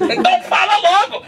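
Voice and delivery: scornful laughing dare. Acted. Atheist dares him to speak.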